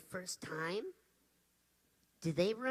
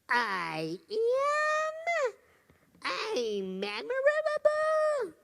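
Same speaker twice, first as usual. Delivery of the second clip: put-on high, loud, delighted child's shout; a little girl shouting with joy; excited little girl; 13 semitones higher, 4 dB louder, much slower than usual. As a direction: cry it out like a thrilled kid. Child joyfully proclaims it.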